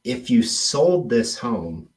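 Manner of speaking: slow, drawn-out "If you sold this home"; "If you sold this home" with SOLD leaned on, left hanging; modelled sales inflection. Shown as a demonstration. Sales coach models the script's inflections, slow and stressed. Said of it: "listen to the inflections in my voice".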